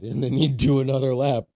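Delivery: normal talking voice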